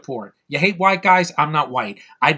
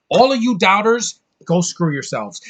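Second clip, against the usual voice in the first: angry rant; 6 semitones higher, 6 dB louder than usual. Real, not acted. Ranting at doubters.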